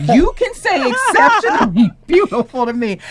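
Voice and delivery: amused repeat of the son's flattery; laughing. Real, not acted. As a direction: giggle while repeating the compliment.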